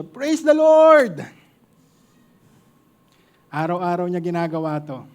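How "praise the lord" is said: loud, high, slow call of praise; drawn-out, high praise yell; joyful shout. Retold or imitated. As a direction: shout it out the window to the whole street. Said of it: "sumisigaw siya".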